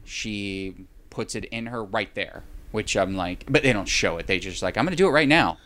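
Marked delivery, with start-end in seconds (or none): 4.8-5.6 a burst of mock eagerness, higher and louder; eager announcement. Retelling a film scene, the character announces it in mock eagerness. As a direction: blurt it out like a hammed-up movie character.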